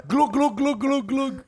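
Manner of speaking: high, loud glug glug glug; drowning glug sounds. Pretend. Doing a Bengali drowning in a monsoon, high glugging. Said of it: "This is an impression of a Bengali in a monsoon".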